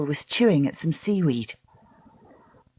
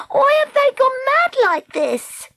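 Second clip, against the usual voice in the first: very high, frantic squeal; panicked question; 4 dB louder than usual. A narrator's reading. Treacle as the eels bite at the boat, panicked.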